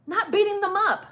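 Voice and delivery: "beating" hit hard, high and loud; a sharp, exasperated scolding; emphatic scold. Acted. Scolding cruise violence.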